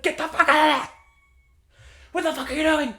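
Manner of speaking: high, loud mock screech; imitated startled yell. Imitating another girl startled in the hallway, high yell. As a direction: yell it high like someone jumped at you. Acting second startled girl's outburst.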